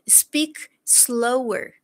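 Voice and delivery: very slow, stretched words; slow demo. Shown as a demonstration. Teacher showing students how to ask, very slowly. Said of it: "Mais devagar, fala mais devagar".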